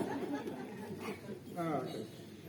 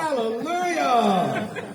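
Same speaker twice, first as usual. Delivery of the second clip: joyful shout; 5 semitones higher, 8 dB quieter than usual. Real, not acted. Host cheers the crowd's yell.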